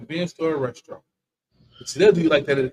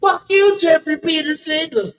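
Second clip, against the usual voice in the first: mock yell; 12 semitones higher, 7 dB louder, much slower than usual. Mock cursing out a friend.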